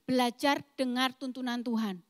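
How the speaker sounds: ordinary talk, nothing marked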